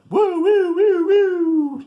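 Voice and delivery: very high, loud wheezing woos; hammed-up gasping for air after the punch; mock gasping. Sly reeling after the punch.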